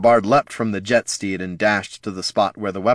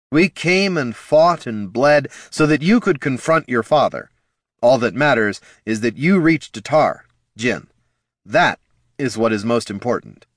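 passionate; 5 semitones higher, 4 dB louder than usual. A narrator's reading. Bard passionately insisting.

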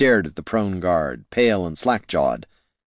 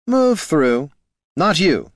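higher, curt order; haughty guard; 9 semitones higher, 4 dB louder, slower than usual. Acted. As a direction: brush them off like a gatekeeper. Elf guard orders them.

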